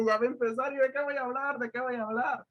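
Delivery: high-pitched mock-anxious plea; retold panic. Preacher recalling his prayer before the service, retold panic. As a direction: replay a rising worry, pitched well up.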